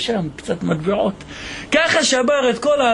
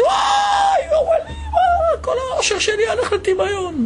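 wailing cry. Acted. Woman finding only honey in her jars, wailing high. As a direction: wail high as if your fortune just vanished. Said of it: "התחילה לבכות".

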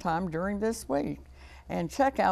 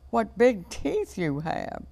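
higher, slow amazed exclamation; crying out; 5 semitones higher, 3 dB louder, slower than usual. A narrator's reading. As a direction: draw out the storybook astonishment.

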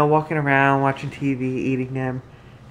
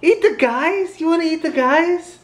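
high playful echo; 12 semitones higher than usual. Real, not acted. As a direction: echo her words up high, amused. Dad echoing toddler, high voice.

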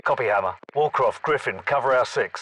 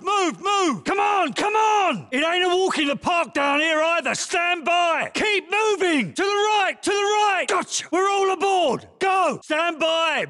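battle shouting. Acted. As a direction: shout orders over gunfire and alarms. Shouting while escaping sinking ship.

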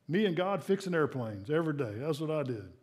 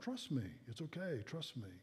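much softer, fading hush; God whispering reassurance, softer and softer; 2 semitones lower, 12 dB quieter than usual. Acted. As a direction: let it fade to a tender murmur. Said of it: "he just whispers in your heart".

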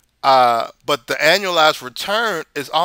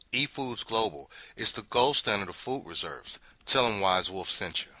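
ad read; 3 semitones lower, 10 dB quieter than usual. Acted. Ad read for sponsor.

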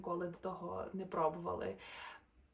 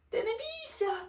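encouraging call; 13 semitones higher, 4 dB louder than usual. Acted. Animals call together.

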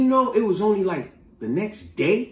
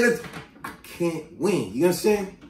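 ordinary delivery

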